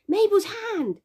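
put-on high, loud toddler squeal; excited child's squeal. A narrator's reading. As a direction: squeal it with delight like a little girl. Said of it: "she squealed".

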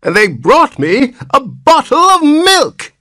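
put-on very high indignant old voice; shrill protest. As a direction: rise into a shrill complaint. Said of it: "his voice rose to a shrill note of protest".

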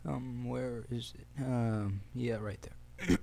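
hoarse, fading voice; strained hoarse voice; losing voice. Real, not acted. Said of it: "I'm losing my voice here".

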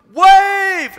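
loud high yelled call; friends yelling. Retold or imitated. Friends on the beach yelling again, loud high call. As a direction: yell it from far off. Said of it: "and they're like".

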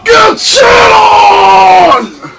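high, drawn-out victory yell; gloating shout. Real, not acted. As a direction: scream it, stretched out and loud. Finishing move on opponent.

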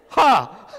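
"Ha!" barked loud from the throat; loud throaty ha. Shown as a demonstration. Preacher calling out the Hebrew H loudly to his listeners. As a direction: punch "Ha!" out from the throat. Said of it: "from the throat".

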